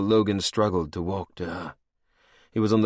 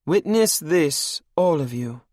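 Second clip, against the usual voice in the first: high, cold and mocking; higher, menacing royal voice; villainess queen; 9 semitones higher, 6 dB louder than usual. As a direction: purr it over your captive like a cruel queen. Anne addresses the court.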